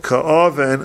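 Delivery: "ka'aven" with a hard k, drawn out; kaf with dagish. Shown as a demonstration. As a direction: open "ka'aven" on a firm k, not ch. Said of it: "with a dagish in the".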